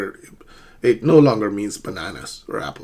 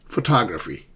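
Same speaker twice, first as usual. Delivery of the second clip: "photography" as pho-TOG-raphy; careful pho-TOG-raphy; middle stress. Shown as a demonstration. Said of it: "in the middle syllable".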